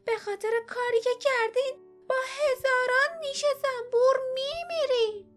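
put-on very high-pitched threatening old woman; old woman's angry cry. Old woman threatening young thieves with her bees, shrill angry cry. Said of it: "پیرزن فریاد کشید".

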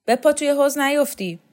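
high, loud teasing shout; jeering call. Acted. Shokat taunts the student by the pool, calling out. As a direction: shout a mocking warning across the garden.

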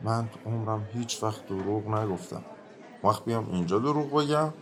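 low, soft mutter of innocence; muttering under his breath. Acted. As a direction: mutter it down at the floor, pitched low. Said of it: "زیر لب میگوید".

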